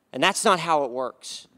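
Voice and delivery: ordinary talk, nothing marked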